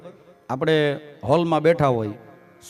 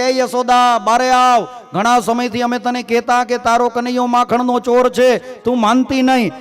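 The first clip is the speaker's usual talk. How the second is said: loud, high calls getting ever louder; gopi shouting; 7 semitones higher, 7 dB louder than usual. Acted. Gopi shouts at Yashoda's door, building to a loud high scream. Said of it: "જોર જોરથી બૂમો નાખે કે".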